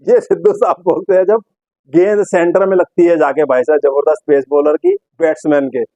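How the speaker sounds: ordinary talk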